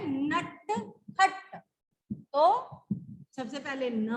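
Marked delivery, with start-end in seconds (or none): 0.1-1.6 नटखट very slow, each sound held; slow sounded word. Shown as a demonstration. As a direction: hold नटखट so every sound is heard. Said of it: "किस किस की sound आ रही है?". (नटखट बहुत धीरे, हर ध्वनि पकड़कर)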